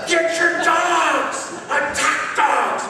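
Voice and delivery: high, loud panicked yell for help; retold yell. Preacher in the mud calling owners about attack dogs, panicked yell. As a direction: holler for the owners, dragging out dogs. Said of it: "And I'm yelling".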